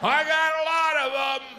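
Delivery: loud, high-pitched mock confession; high shout back. Waiting customer answering nosy onlookers, high and loud.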